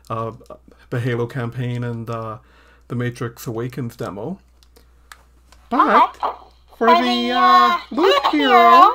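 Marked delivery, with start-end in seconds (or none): 5.7-8.9 very high, slow pitched-up elf voice; elf voice changer. Pretend. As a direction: talk through a squeaky elf voice changer. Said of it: "it's my elf voice changer".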